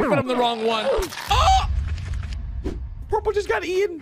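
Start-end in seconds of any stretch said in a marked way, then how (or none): 1.3-4.0 sharp high startled oh; startled cry. Real, not acted. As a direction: jump at a scare. Sudden scare in game.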